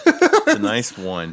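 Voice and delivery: loud. Real, not acted. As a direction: boom it out slowly. Player reacts to roll.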